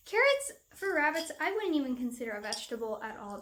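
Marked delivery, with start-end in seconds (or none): 0.1-1.3 laughing in disbelief; incredulous, high and laughing; scoffing laugh. Real, not acted.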